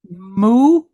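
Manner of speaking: "Mu" said as moo, with a long oo; like a cow's moo. Shown as a demonstration. Teacher likens the syllable to a cow, saying "Mu" as moo. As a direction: say "Mu" like the word moo. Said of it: "like a cow says".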